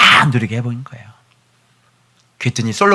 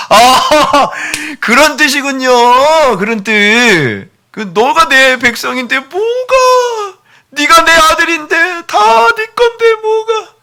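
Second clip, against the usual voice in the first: slow, high, crying voice; choked-up wonder, near sobbing; real tearful; 14 semitones higher, 5 dB louder, much slower than usual. Real, not acted. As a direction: let the tears break through slowly. Preacher moved to tears.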